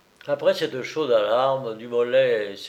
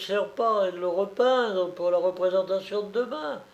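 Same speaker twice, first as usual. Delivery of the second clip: much higher, gloating showwoman; fat colombine; 6 semitones higher than usual. A narrator's reading. Narrator voicing the colombine.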